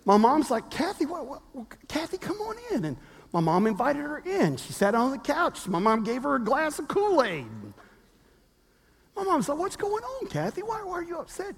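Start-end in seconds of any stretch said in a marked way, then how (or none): 0.7-2.8 softly voiced mom, higher and much softer; caring mom. Mom comforting crying girl. 9.6-11.6 softly voiced mom, higher and much softer; caring mom. Mom asks upset girl.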